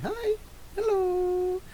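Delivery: very high, squeaky hello; cutesy pet greeting. Real, not acted. Greeting Bagel walking on me, cutesy squeak. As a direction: squeal a long, cute hello.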